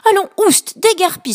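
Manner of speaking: shooing him off, high and loud with scorn; disdainful. Acted. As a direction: brush the little fish away haughtily. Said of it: "s'exclama Arc-en-ciel avec dédain".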